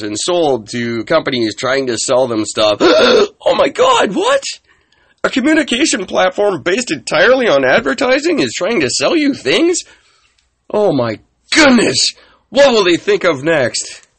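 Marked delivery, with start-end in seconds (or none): 2.8-3.4 loud, high sarcastic gasp; hammed-up gasp of surprise; mock gasp. Mocking naive Facebook users. 3.5-14.1 hammed-up amazement; mock astonishment. Sarcastic mock shock at users.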